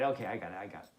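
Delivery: ordinary delivery, nothing marked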